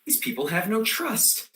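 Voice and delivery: normal talking voice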